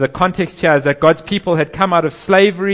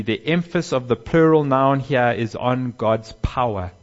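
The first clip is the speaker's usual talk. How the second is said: ordinary talk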